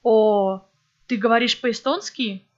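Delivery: ordinary talk, nothing marked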